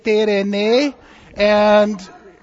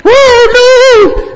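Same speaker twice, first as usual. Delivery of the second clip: hammed-up cry of alarm, much higher and louder; mock panic; 16 dB louder than usual. Storyteller voicing the scared disciples for children, hammed-up wail. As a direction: wail it like a frightened sailor.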